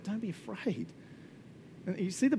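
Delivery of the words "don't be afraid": gentle reassurance. Pretend. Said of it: "he's like".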